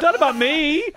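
high, loud protest over a laugh; comic mock yell; yelling from stage. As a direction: call it out to the room. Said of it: "I'm yelling from stage".

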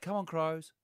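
polite, high-pitched little cheer; Christopher Pine. Retold or imitated. Polite supporter cheering the Crows, high restrained mock cheer. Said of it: "such a polite football supporter".

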